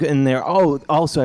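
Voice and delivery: ordinary talk, nothing marked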